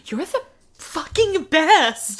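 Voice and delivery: acted gushing. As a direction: draw it out like an adoring fan. Acted gushing at favourite wrestler.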